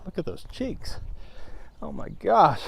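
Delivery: high, soft, cooing tease of his own cheeks; mock teasing. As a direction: coo at the cheeks like a doting aunt. Teasing himself about chubby cheeks.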